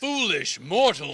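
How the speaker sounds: slow booming villain proclamation; booming demon lord. Acted. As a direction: announce it like a dark overlord. Piper's grand return entrance.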